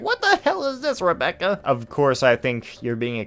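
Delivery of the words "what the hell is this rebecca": high, exaggerated indignant squeal; mock outrage. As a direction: ham up the disgust. Mocking quote of the reviewer.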